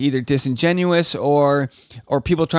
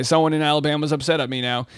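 ordinary delivery

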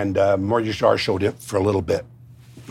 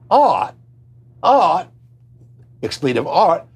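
much higher, louder scoffing at the word art; indignant outburst; 8 semitones higher, 6 dB louder, slower than usual. Retold or imitated. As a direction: bellow it back in disbelief. Retold Freddie Young scoffing.

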